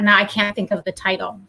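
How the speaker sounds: normal talking voice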